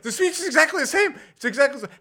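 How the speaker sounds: high, fast, insistent; squeaky excited retelling; excited insistence. Retold or imitated. Retold excited explaining to wife.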